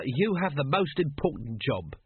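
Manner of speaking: plain reading voice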